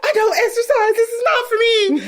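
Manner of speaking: high-pitched imitation of a complainer; hammed-up helpless protest; mocking whine. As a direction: whine it in mock distress. Mimics her complaining on the hike.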